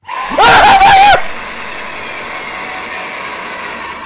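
high screaming laugh of panic; shriek-laugh in terror; panicked shrieking laugh. Real, not acted. Panicked laugh during chase.

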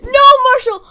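high, loud cry of protest; dismayed shout. Real, not acted. Player who has to kill Marshall, dismayed shout. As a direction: cry out to stop the inevitable.

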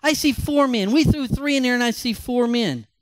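high, excited king repeating what he sees; astonished king. Acted. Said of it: "Nebuchadnezzar shouted".

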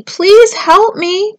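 high, drawn-out plea; pleading yell. A narrator's reading. Stranded Tudley begs the turtles, pleading yell. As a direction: beg slowly in a high voice. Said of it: "yelled Tudley".

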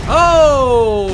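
sudden loud yelp of surprise; startled shout. Real, not acted. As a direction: jump and shout oh.

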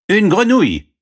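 high, loud servant voice. Acted. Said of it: "répondit le serviteur". (voix aiguë de serviteur)

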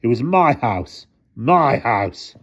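anguished protest. A narrator's reading. Mister Thomas mourns his home to the driver, anguished. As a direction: lean hard on MY, aggrieved.

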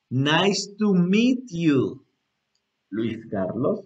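very slow, with a gap before the name; slow example reply. Shown as a demonstration. Teacher answering as the other person, very slowly. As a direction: draw out each word for learners. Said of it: "este, fluido otra vez".

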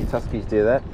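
normal talking voice

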